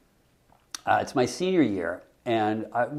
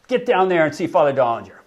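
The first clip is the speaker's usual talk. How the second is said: louder stern command from the priest; stern order; 4 semitones higher, 10 dB louder than usual. Retold or imitated. Priest ordering the student down to the principal, stern. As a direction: send him off firmly.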